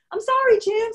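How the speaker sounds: high, loud, sheepish apology; the caught friend blurts a high apology. Retold or imitated. Mistress apologizing to the wife as she flees, high and sheepish. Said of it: "And then the woman, she's".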